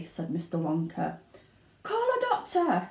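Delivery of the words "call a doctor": very high urgent call; shouted. Acted. Father shouts for a doctor, urgent. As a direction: cry out for help. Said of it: "shouted Mister Beauregard".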